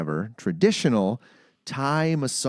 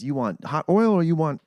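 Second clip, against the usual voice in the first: higher-pitched mock Thai accent; bad Thai accent; 7 semitones higher, 3 dB louder than usual. Masseuse offers client options, mock Thai accent. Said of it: "That is what she sounded like".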